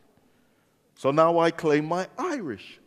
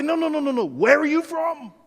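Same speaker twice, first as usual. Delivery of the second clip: high, insistent no no no; pressing him on where he's from; insistent questioner; 9 semitones higher than usual. Retold or imitated. A local pressing him, high and insistent. Folk questioning his origin.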